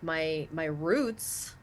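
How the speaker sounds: ordinary delivery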